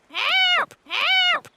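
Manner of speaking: squawky high parrot echo. Acted. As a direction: parrot repeats the cry back. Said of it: "Grandpa Pig says".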